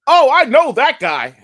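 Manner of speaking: slow, loud, laughing recognition; laughing excited shout. Retold or imitated. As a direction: burst out laughing as he recognises him. He recognises Chocolate.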